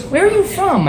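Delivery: very high, imitated female voice; exaggerated astonished woman; incredulous woman. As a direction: shoot the question up high in mock bafflement. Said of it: "She goes".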